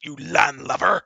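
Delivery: gravelly character voice. Acted. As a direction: growl out a comic insult, slowly. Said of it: "all that gravel to your voice".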